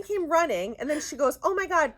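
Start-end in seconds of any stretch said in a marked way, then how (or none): 1.4-2.0 higher, louder mock panic; retold alarm. Friend fearing the husky escaped too, mildly panicked. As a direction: exclaim it as the worried friend.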